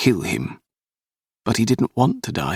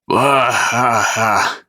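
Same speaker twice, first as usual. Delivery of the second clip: louder, higher guffaw from a brute; mocking belly laugh; 4 semitones higher, 4 dB louder, much slower than usual. A narrator's reading. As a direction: laugh in his face, doubling over. Men burst out laughing at Percy.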